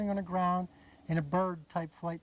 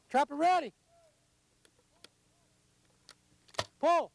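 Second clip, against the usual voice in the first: loud raised field call; 10 semitones higher, 5 dB louder than usual. Real, not acted. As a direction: shout it across the range. Guide calls to the trapper.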